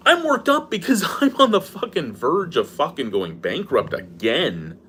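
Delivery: ordinary talk, nothing marked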